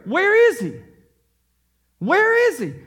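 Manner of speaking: high searching cry of where is he; magi asking. Retold or imitated. Magi to Jerusalem, a high loud searching call. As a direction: call out like travellers searching the city. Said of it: "their message is clear".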